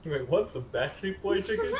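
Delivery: high startled disbelief; surprised. Real, not acted. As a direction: blurt it out confused. Confused by odd phrase.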